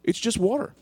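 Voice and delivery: high-pitched, repeated retold taunt; mock innocence. Storyteller taunting the soaked hose man, mock innocent. Throws the man's phrase back.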